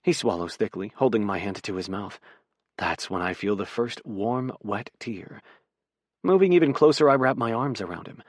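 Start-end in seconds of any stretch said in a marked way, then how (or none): none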